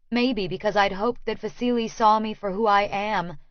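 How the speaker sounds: ordinary delivery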